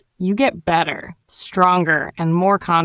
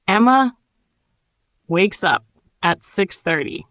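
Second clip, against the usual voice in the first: broken into phrases with long pauses; nice and slow. Shown as a demonstration. Teacher models the sentence for learners, nice and slow. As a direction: leave room after each chunk to repeat. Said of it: "Nice and slow".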